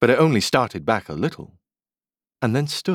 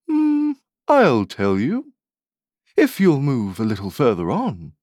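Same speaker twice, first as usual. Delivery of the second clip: pondering fawn; 5 semitones higher than usual. Acted. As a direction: think it over, then offer it unhurriedly. Fawn considers Alice's request.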